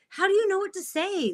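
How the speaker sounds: mock-surprised, voice lifted, level unchanged; surprised question. Boss amazed she can greet people in Japanese, mock surprise.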